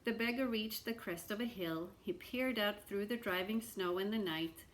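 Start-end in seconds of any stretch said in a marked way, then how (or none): none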